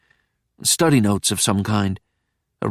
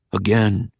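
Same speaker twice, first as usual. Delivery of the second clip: deep voice. Acted. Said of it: "this deep voice said".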